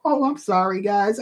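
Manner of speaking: ordinary talk, nothing marked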